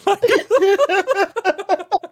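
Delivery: high-pitched burst of laughter. Real, not acted. Laughing at own joke.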